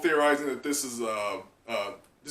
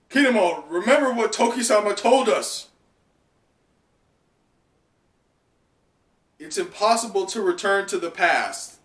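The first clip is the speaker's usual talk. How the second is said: higher, louder urgent appeal; Raizo pleading; 7 semitones higher, 6 dB louder than usual. Acted. Raizo reminding Kinemon of Toki's words, raised urgency. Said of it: "he pipes up and says".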